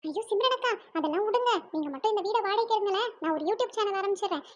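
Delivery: ordinary talk, nothing marked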